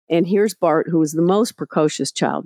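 usual speaking voice

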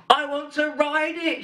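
high, eager child's exclamation, imitated; excited exclamation. Daughter sees her new bike and wants it now, excited exclamation. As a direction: exclaim it like a thrilled kid, pitched right up. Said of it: "she exclaimed".

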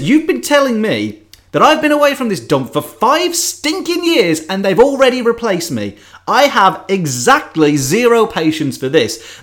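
acted fed-up outburst, pitched high; high, mock-indignant complaint; exasperated rant. Acts Rocket's fed-up outburst.

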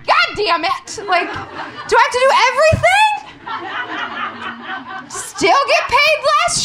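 high, hammed-up exasperation; exasperated outburst. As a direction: blow up in comic indignation. Acted exasperation over condom question.

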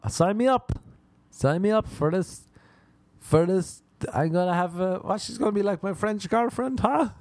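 high, eager and giddy; eager kid. Retold or imitated. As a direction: jump at the offer like an excited boy.

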